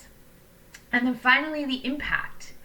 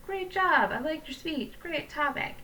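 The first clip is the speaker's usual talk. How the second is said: high, breezy throwaway compliments; generic empty praise; 7 semitones higher than usual. Shown as a demonstration. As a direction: rattle off bland praise lightly. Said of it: "Don't just say".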